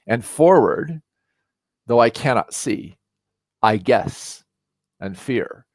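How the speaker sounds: put-on slow, measured recital of old Scots verse; dwelling on each line of verse; poem recital. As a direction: read it like a cherished poem, unhurried. Poem's closing lines.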